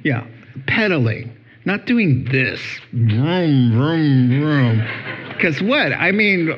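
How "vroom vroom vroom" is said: engine vroom. Retold or imitated. Pastor mocking bikers riding motorbikes, playful engine noise.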